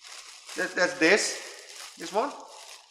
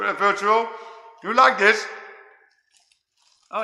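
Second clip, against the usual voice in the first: ordinary delivery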